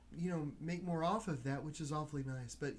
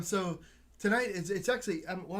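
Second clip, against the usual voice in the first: usual speaking voice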